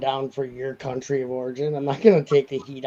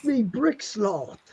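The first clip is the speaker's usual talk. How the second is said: higher, slow mock British accent; 5 semitones higher, slower than usual. Teasing a British-seeming friend in mock British accent. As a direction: drag it out in a hammy British accent. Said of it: "Brick Sloth is so British".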